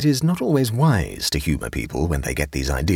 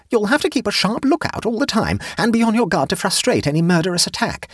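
high-pitched, alarmed woman; high voice giving anxious orders; Jane insisting; 4 dB louder than usual. A narrator's reading. Jane anxiously demands a lookout.

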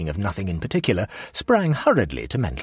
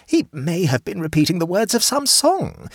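hopeful, higher-voiced suggestion; hopefully; 12 semitones higher, 4 dB louder than usual. A narrator's reading. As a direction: offer it with a hopeful lift. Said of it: "said Missus Riversedge hopefully".

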